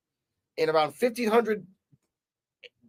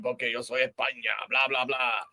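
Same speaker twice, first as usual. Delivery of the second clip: lower, softer swaggering Spanish villain; cocky conqueror impression, pitched down; mock confident boast; 5 semitones lower, 5 dB quieter than usual. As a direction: gloat in Spanish with a staged laugh. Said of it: "goes up all confidently".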